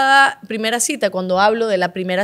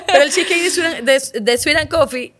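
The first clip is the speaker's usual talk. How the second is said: slowed, laughing through the brand name; laughing plug; 4 semitones higher than usual. Real, not acted. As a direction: giggle the product name. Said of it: "laughing over the sponsor joke".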